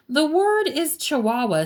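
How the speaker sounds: high, frazzled mama correction; mildly exasperated storybook mother. A narrator's reading. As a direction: correct them with worn patience.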